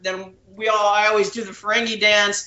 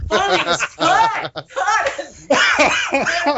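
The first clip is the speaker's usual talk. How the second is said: high, drawn-out mock shout; hammed-up pleading yell; desperate cry; 7 semitones higher, much slower than usual. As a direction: call it out high as if at your limit. Desperate to hear cut, retold.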